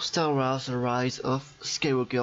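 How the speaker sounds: slow, mock English accent on the title. Shown as a demonstration. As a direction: say the film title like an Englishman, slowly. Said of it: "avec mon magnifique accent anglais".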